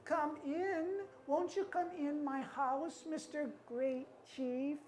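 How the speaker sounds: put-on high, soft old-woman voice; inviting old woman. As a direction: invite the guest in sweetly, high and quiet.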